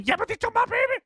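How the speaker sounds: raptor hissy fit. Retold or imitated. Blue the raptor blaming Owen, high shrieking hissy fit. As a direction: squeal it like an outraged raptor mum. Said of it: "has a full-on hissy fit".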